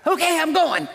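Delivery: high, loud and eager; mock eager kid, high and loud; eager mock reply. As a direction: bounce off like a kid let loose. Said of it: "then you go".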